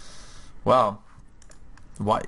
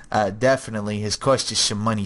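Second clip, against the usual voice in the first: ordinary delivery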